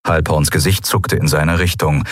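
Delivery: plain reading voice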